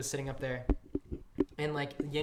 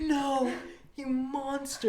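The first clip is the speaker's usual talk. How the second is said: high, laughing mock accusation; laughing mock outrage; 9 semitones higher, 3 dB louder, much slower than usual. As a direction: cry it out through laughter, higher. Coworker freaks out over trash.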